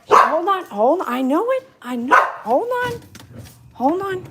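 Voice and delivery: high, loud, urgent calls to settle the dog; excited hold on. Real, not acted. Owner to her excited dog during firecrackers, high urgent calls.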